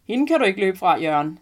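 the stranger joking loudly to her father. A narrator's reading. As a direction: call it out big and jolly. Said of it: "siger manden med en høj stemme".

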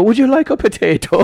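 high, loud put-on Irish lilt; Irish accent. Embarrassed teller re-enacts greeting his crush, in an Irish accent. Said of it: "in an Irish accent".

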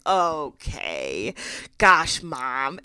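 drawn-out grudging "okay"; retold exasperation at mom; exasperated giving in. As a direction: give in like a needled daughter. Caught out by prying mom.

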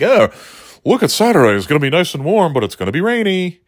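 chirpy weather-report read; weather forecaster voice. Retold or imitated. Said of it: "everybody's talking about, like".

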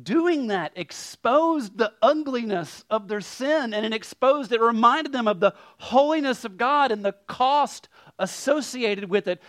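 high, driving preacher's voice; forceful preaching. Real, not acted. Preacher explaining to the congregation what the ritual exposed, forceful.

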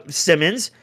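normal talking voice

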